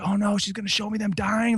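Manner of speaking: high, frightened realization; dread. Retold or imitated. Me seeing my kids, frightened and pleading. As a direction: horror dawning in a high voice. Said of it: "I was like".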